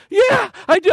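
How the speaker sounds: put-on high, eager childish yes; a small girl jumping at the offer; eager little girl. Daughter answers father.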